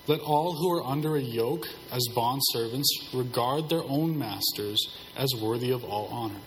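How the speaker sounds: usual speaking voice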